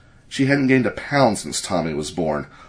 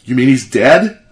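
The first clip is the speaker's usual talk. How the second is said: loud, raised, disbelieving question; startled outcry, much louder and higher; shocked cry; 4 semitones higher, 9 dB louder than usual. A narrator's reading. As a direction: cry it out in shock, rising at the end. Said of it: "cried Vladek".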